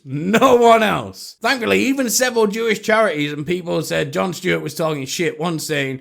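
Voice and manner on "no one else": sarcastic drawn-out. Real, not acted. Mocking Stewart's claim.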